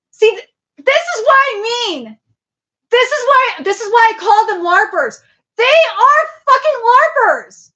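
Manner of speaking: high, loud exasperated ranting; angry rant. Real, not acted. To chat, about the New York larpers, loud angry rant. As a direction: yell at the screen in disgust.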